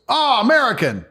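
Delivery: high mocking greeting from a Moldovan local; mocking local guy. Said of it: "And this guy goes".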